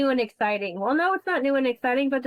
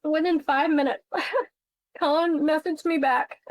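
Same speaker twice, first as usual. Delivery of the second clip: usual speaking voice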